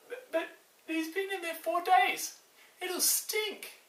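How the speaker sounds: a worried sister balking at the smell; fretful Martha. Acted. Martha to Jesus, objecting to opening the tomb, high and fretful.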